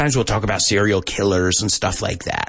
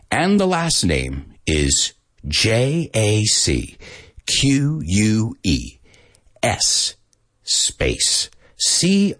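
letter by letter, slow and clear; deliberate dictation; slow spelling; slower than usual. Shown as a demonstration. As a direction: spell it out for the class. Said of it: "tell me what the names are".

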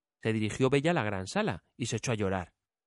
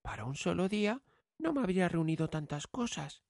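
put-on young girl voice; 6 semitones higher, 5 dB quieter than usual. A narrator's reading. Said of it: "y añadió de inmediato".

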